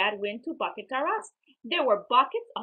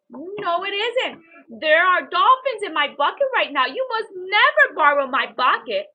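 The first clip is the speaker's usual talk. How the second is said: higher and louder protest; firm refusal; 7 semitones higher, 10 dB louder than usual. A narrator's reading. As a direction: refuse firmly, guarding the bucket. Child refusing to lend bucket.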